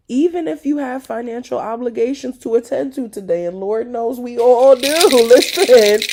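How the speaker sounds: rising into a loud laugh. Real, not acted. Laughing aside about money worries.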